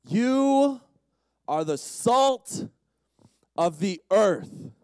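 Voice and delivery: loud, slow proclamation, word by word; emphatic verse reading. Real, not acted. Preacher repeats the student's verse to the room, emphatic. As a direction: declare it at full volume, drawing out each word.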